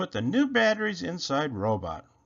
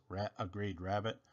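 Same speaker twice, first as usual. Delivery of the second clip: plain reading voice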